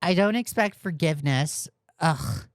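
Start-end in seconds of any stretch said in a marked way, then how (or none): 2.0-2.5 annoyed groan. Real, not acted. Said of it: "Eye roll".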